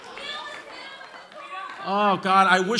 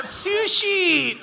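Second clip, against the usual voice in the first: put-on high, piercing squeal of a girl's voice; mock shrill girl shouting sushi; 9 semitones higher than usual. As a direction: shriek it like the girl in the crowd.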